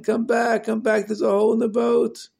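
high, urgent shout across the water; yelling. Acted. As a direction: yell a warning to the boat. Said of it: "and yelling".

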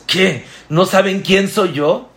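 a proud man insulted he was passed over; offended outburst. Acted. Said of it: "ardiéndose". (arrebato ofendido, más agudo y fuerte)

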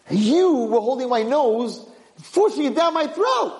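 high, emphatic recollection; acted outraged remembering; excited accusation. Prince recalls forced drink.

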